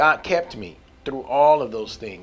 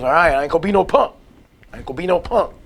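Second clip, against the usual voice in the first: faster, louder streetwise bravado; 4 semitones higher, 4 dB louder, faster than usual. Retold or imitated. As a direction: toss it off like a tough street talker.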